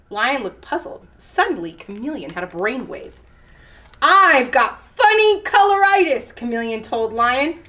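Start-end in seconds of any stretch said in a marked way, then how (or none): 4.0-6.3 high, emphatic declaration; bluffing announcement. A narrator's reading. Chameleon inventing an illness for lion, high and emphatic. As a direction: proclaim it with pitch up.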